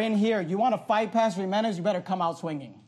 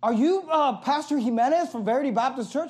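higher, louder voice for the stranger; a lightly voiced loud stranger, raised and pressing; 5 semitones higher than usual. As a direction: imitate a pushy man calling out. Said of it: "he starts talking really loud to me".

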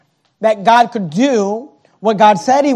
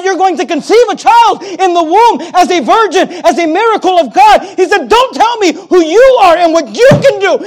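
higher, louder, preaching at full force; fiery preacher voicing Gabriel; angel's thunderous rebuke; 8 semitones higher, 5 dB louder than usual. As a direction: rebuke him like a furious archangel.

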